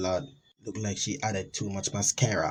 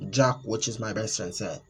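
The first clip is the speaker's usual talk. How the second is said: ordinary delivery, nothing marked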